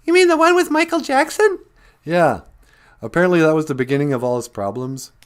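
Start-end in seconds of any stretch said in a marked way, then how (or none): 0.0-1.6 much higher, louder squeaky pet voice; pet's voice. Pretend. Host greeting viewers on behalf of his pet, much higher cutesy voice.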